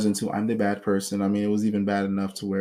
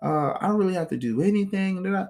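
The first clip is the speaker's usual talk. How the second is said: mock bragging, pitched higher; boastful girl; 7 semitones higher than usual. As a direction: brag like she's got it made. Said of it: "was boasting about it".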